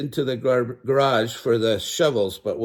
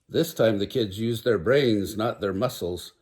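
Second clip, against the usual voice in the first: ordinary delivery, nothing marked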